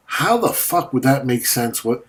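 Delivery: usual speaking voice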